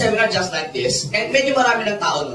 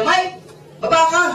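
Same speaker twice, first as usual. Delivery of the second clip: higher call from below the window; 7 semitones higher than usual. Retold or imitated. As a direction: call Mike down from the street. Friend calling up from car.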